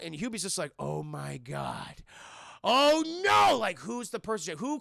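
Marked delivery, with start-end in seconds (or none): 0.8-2.0 slow, low, hushed awe; drawn-out, softly stunned reaction; stunned realization. Retold or imitated. Acted stunned reaction to revelation. 2.6-3.6 loud, high dramatic cry; big theatrical high exclamation; mock horror. Acted horror at the revelation.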